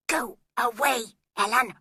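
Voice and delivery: usual speaking voice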